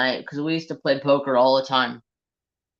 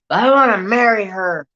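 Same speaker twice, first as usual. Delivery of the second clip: put-on drunk lovesick plea; 6 semitones higher, 6 dB louder than usual. Drunk friend voiced in story.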